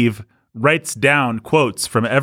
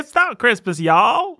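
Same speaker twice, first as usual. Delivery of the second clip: quoting catchphrase; 9 semitones higher, slower than usual. Retold or imitated. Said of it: "quotable line".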